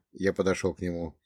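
plain reading voice

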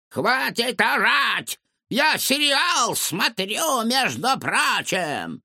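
high, slow, scolding granny; cranky old woman. A narrator's reading. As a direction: nag like an annoyed neighbor. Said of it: "крик старушки-соседки".

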